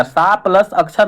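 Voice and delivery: सा said with a long open "aa"; the wrong split, with long "saa" for सा; long vowel in सा. Shown as a demonstration. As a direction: stretch सा to "saa" before plus अक्षर. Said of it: "गलत हो जाएगा".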